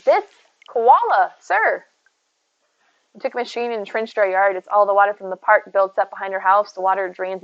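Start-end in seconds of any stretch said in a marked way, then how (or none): 0.0-1.8 high, slow fed-up whine at Gonzo; exasperated groan. Real, not acted. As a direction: sigh it out, really over it. Unwanted visitor Gonzo returns.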